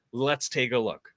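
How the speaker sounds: ordinary delivery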